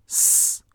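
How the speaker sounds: "س" as a voiceless hiss; isolated s sound. Shown as a demonstration. Teacher lists the three suffix sounds, hissed voiceless s. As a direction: say "س" alone, held briefly with no voice. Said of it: "سه نوع تلفظ داره".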